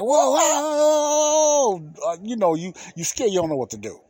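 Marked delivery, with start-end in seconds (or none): 0.0-2.1 high, loud, drawn-out whoas with a cry; stretched frightened yelling; terrified whoa. Retold or imitated. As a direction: plead with the dog to stop, scared. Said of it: "And I'm like".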